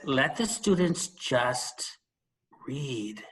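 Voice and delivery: slow, with a held pause before read; pause for effect. Shown as a demonstration. Teacher quoting Krashen to colleagues, slow with a dramatic pause. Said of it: "Pausing for effect".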